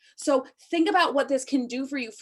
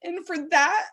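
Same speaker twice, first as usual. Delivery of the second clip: pushing through real emotion, high and strained; choked-up heartfelt plea; emotional; 9 semitones higher than usual. Real, not acted. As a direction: voice pitched up high with held-back tears. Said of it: "I got a little emotional".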